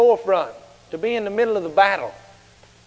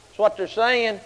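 ordinary delivery

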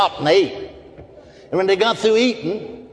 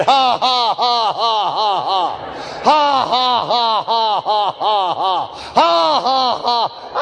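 forced laugh; 5 semitones higher, much slower than usual. Retold or imitated. Man laughs at the devil, forced hearty ha-has. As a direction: laugh on purpose, in even spoken bursts. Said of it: "I didn't feel like laughing".

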